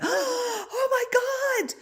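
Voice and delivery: very high, slow, hammed-up wail; mock dramatic. Mocking the drama-loving ego, hammed-up wail. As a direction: overplay it like a soap opera. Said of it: "everything is so dramatic".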